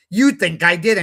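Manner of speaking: a retold flash of temper, pushed up and loud; getting angry. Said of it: "Raskolnikov getting increasingly angry".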